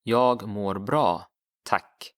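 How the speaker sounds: teacher answers the drill question for learners; Swedish phrase. Shown as a demonstration. Teacher models the reply slowly. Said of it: "So how would you say".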